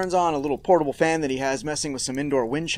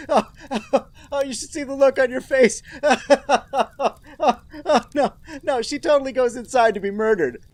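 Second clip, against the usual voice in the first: teasing laughter; 8 semitones higher than usual. Acted.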